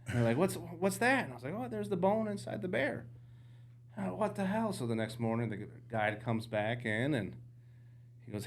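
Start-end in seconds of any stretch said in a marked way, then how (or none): 0.4-1.2 high, surprised question; puzzled. Retold or imitated. Hunters' puzzled reply. 1.5-4.7 high, surprised reaction. Retold or imitated. Hunters' reactions retold.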